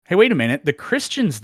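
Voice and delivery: usual speaking voice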